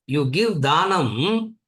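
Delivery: ordinary delivery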